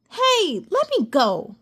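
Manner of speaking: protest. Acted. Cassie grabbed, protesting.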